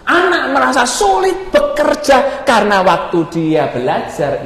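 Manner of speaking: high, urgent pulpit voice; impassioned preaching. Acted. Preacher warning about wasted time, impassioned.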